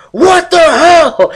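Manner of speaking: high, loud, slow outraged shriek; mock shrieking mom. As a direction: ham up a mom catching her kid on the TV. Mom catches him playing again.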